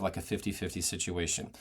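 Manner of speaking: normal talking voice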